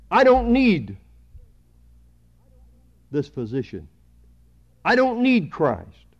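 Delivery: emphatic, high-pitched refusal dropping on the middle phrase; self-righteous refusal. Acted. The self-righteous turning Christ away, emphatic refusal. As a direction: push out each refusal high, then let it fall.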